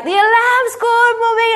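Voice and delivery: little son calling mummy about the alarm; voicing small son. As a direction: call out like a little boy from downstairs. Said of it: "And James is like".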